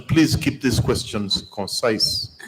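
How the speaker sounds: ordinary talk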